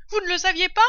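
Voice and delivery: very high, loud question; s holler, thrown as a question; calling down. A narrator's reading. Mistress asking the servant below, calling down.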